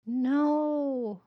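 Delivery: long, high, loud cry of horror; scream. A narrator's reading. Horrified children watching the car, drawn-out scream. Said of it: "Their silent screams of".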